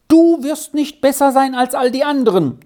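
high, loud angry interruption; cutting in angrily. A narrator's reading. Farmwife interrupting the girl, loud and angry. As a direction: snap over the girl's words. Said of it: "unterbrach sie die Bäuerin".

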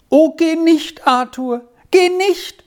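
high-pitched pleading outcry; child's cry. A narrator's reading. Squirrel begs Arthur not to leave, high pleading cry. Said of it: "schrie Squirrel auf".

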